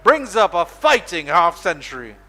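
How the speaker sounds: high, loud, slowing on the milestone; excited boundary call. Real, not acted. Commentator hailing the batter's fifty, loud and high. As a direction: build the call up and savour the fifty.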